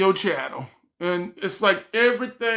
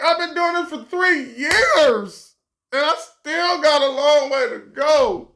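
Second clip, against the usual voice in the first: mock whimper; 6 semitones higher, 3 dB louder than usual. Vlogger complaining about three years of work, mock tearful whine. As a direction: plead with a half-crying laugh. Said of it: "I'm like".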